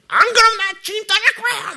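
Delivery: very high, loud screeched ultimatum; threatening tantrum. Acted. Believer threatening to leave the Lord, screeched tantrum. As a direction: blackmail like a screaming child in a fit. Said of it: "막 이렇게 협박해".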